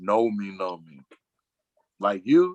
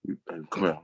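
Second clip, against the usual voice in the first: discreet hushed tip-off; 5 semitones lower, 7 dB quieter than usual. Retold or imitated. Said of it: "go tell that person, like".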